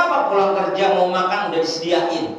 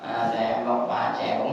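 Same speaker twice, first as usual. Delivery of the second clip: put-on low, soft child's plea; whiny child; 7 semitones lower, 5 dB quieter than usual. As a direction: beg dad quietly like a little kid. Child asks father to wipe. (rengekan anak pelan)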